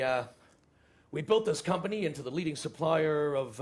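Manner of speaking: usual speaking voice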